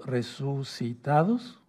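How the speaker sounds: "resucitados" muy lento, por sílabas; dictado silabeado. Shown as a demonstration. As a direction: alarga "resucitados" para que lo copien. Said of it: "anótenlo". ("resucitados" en dictado despacioso, con final ascendente)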